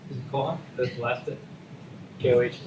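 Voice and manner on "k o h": ordinary talk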